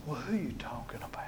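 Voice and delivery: near-whispered, quick acted question; hushed question. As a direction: ask it under your breath. Hushed reply to the Spirit.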